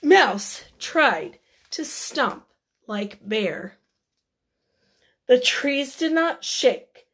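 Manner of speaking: usual speaking voice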